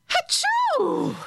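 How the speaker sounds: high squealing laugh into a sneeze; giggle, then burst into a shrill sneeze; laughter sneeze. Shown as a demonstration. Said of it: "funny sneeze".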